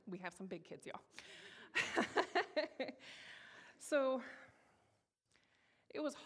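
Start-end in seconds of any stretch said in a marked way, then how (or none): 1.1-3.7 high burst of laughter; real amused laugh, high and rolling. Real, not acted. Laughs at big kids remark.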